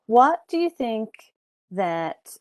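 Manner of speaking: normal talking voice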